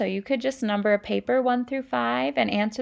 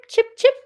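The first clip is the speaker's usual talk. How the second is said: high chirping chip chip; bird chirp; 13 semitones higher, 8 dB louder than usual. Acted. Teacher voicing sparrows for kids, high chirp.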